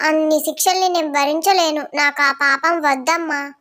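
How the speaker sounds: a small son backing away from punishment; scared little boy. Acted. Son refuses to share sin.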